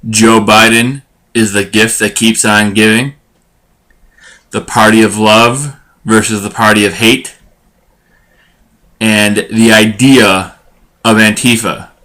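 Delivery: measured headline read, pausing between items; slow, deliberate podcast teaser delivery; show intro read. Acted. Host teasing this week's topics to listeners, announcer-style intro read. Reads the show's opening teaser.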